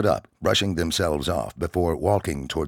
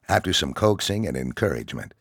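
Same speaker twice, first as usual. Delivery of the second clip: ordinary delivery, nothing marked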